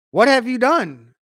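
God calling her to account, slowly and loudly; stern demand. A narrator's reading. God confronting the woman in scripture.

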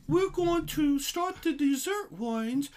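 high, slow, prim lady announcing; the tasting hostess announces to the group, high and slow. Retold or imitated. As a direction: play the fussy wine hostess, slow and high. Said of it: "the lady goes".

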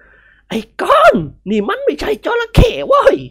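put-on high, slow, loud old lady's scold; shaken old lady. Grandmother scolding Nikorn, slow and loud. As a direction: drag out each word in shock.

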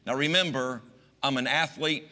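ordinary delivery

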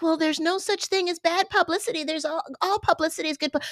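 high, soft, smug mock voice; mocking quote. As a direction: mimic a smug know-it-all. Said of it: "people who are like".

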